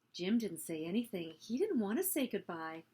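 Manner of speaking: usual speaking voice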